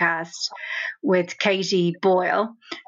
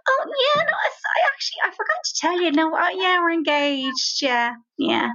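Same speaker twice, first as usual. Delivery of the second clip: casual quote; 8 semitones higher than usual. Retold or imitated. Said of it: "And Julie casually says".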